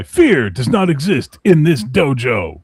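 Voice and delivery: loud, slow, drawn-out movie sensei; dojo sensei impression. Karate teacher to a frightened student, loud and commanding. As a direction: bark it slowly like a dojo master.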